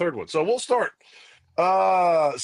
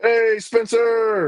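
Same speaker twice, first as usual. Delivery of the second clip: slow, higher, louder hello; drawn-out happy greeting; 9 semitones higher, 5 dB louder, much slower than usual. Real, not acted. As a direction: stretch out the hello to a friend. Greeting a viewer in chat.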